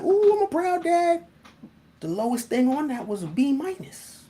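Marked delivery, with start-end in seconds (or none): 0.0-1.2 high, gushing proud-dad squeal. Retold or imitated. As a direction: beam it out, way up high. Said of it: "I'm like".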